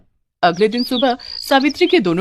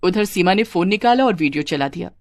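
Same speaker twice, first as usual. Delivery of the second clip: usual speaking voice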